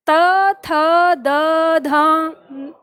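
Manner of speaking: त, थ, द, ध each said apart, tongue on the teeth; dental letters demo. Shown as a demonstration. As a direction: sound out त थ द ध clearly. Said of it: "जैसे ही आप दंते पे आते हैं". (त, थ, द, ध का दाँतों से स्पष्ट उच्चारण)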